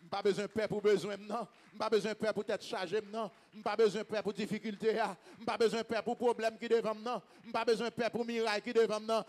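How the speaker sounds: ordinary talk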